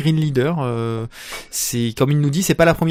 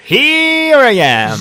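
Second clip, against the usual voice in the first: hammy high voice; 11 semitones higher, 8 dB louder, much slower than usual. Acted. Hammed entrance reply.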